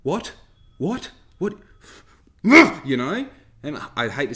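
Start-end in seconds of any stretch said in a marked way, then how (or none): 0.0-1.5 high, dopey repeated what; a hammed-up clueless old driver; mock clueless driver. Mimicking a clueless slow driver. 2.4-3.3 bursting out laughing, much louder; loud laugh into you know. Real, not acted. Laughs at own impression.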